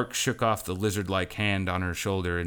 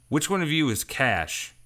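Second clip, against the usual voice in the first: nervous question; 8 semitones higher, 3 dB louder than usual. A narrator's reading. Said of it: "his knees trembling".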